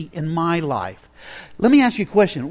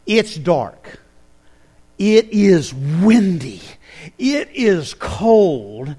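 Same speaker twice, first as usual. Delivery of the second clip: slow dramatic scene setting; 4 dB louder, much slower than usual. A narrator's reading.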